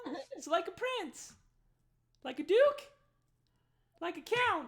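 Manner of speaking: very high questions, rising then falling; squeaky Darnsky guessing titles; teasing squeaky host. Acted. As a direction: needle him with each title.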